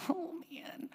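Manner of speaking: breathy little whisper; whispered child. Retold or imitated. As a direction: whisper it like a caught toddler. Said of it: "she's like".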